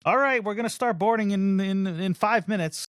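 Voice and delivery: high, upbeat imitated gate announcement; good mood. Gate agent announcing that boarding starts soon, in a good mood. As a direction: lift it high like a cheered-up agent. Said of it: "you could tell she's in a good mood".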